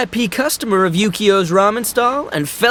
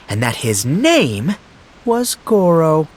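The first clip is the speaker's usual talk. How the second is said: ordinary talk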